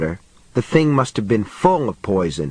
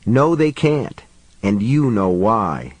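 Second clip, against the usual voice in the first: ordinary delivery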